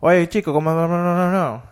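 high, loud mock Puerto Rican chatter; mocking fast Puerto Rican accent. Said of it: "I really hate the fast talking".

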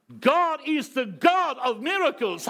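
slow, loud English proclamation; preaching proclamation. Real, not acted. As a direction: declare it to the congregation.

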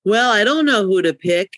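ordinary talk, nothing marked

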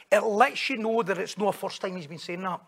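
ordinary delivery

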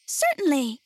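lightly squeaked storybook mouse; squeaky little voice. A narrator's reading. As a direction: answer as a tiny squeaky queen. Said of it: "in her squeaky little voice".

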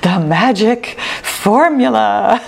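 slow, drawn-out dramatic announcer; super dramatic voice. Retold or imitated. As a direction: stretch the title like a trailer voice. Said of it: "my super dramatic voice during the intro".

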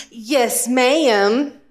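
East Texas drawl, "ma'am" stretched into extra syllables. Shown as a demonstration. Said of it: "stretching that word into more syllables than it ever needed".

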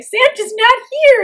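high, slow squeaky elf voice; mock elf voice. Mocking the movie elves, high squeaky voice.

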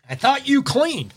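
high, incredulous accusation; doubting partner. Acted. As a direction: throw it back at them, disbelieving. Partner accusing about cleaning.

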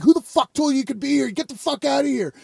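high-pitched mock yell without the volume; imitated angry man. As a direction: rattle off an irate challenge. Retelling angry man confronting him.

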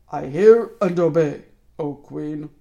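loud, slow growling wolf. A narrator's reading. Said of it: "growled the wolf".

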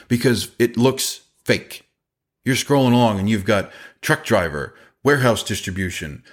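normal talking voice

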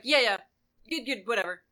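loud, brushing-off mock agreement; dismissive. Mimicking someone brushing off the speaker's hurt, dismissive. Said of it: "like, very dismissive".

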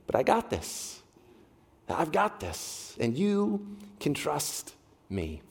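soft, slow, calm voice of God; quiet reassurance. Retold or imitated. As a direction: reassure gently, almost hushed.

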